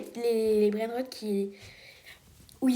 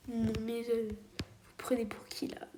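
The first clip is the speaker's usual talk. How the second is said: whispered indignation; 9 dB quieter than usual. Real, not acted. Indignant aside to the thieves.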